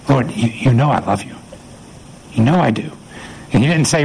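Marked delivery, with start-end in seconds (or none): 0.1-2.9 understated, halting plea; pleading. Acted. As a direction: plead gently, as if needing to be believed. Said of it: "hear the pleading in Peter's voice".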